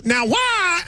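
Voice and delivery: mocking the impression. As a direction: parrot the line back in a shout. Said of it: "I do not go".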